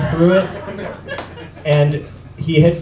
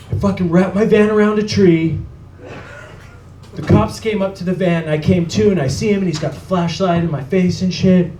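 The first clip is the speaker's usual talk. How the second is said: higher-pitched imitation of a shaken friend; upset retelling; 5 semitones higher than usual. Johnny tells his friend about the crash, upset. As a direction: recount the crash as an upset caller would. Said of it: "he sounded pretty upset".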